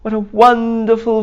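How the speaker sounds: high, boastful goat voice; bragging goat. Acted. Goat boasting to the townsfolk, high and proud.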